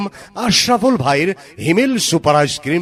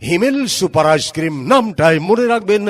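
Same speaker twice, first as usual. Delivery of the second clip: ad announcer; 5 semitones higher than usual. Acted. Loud mic ad promo.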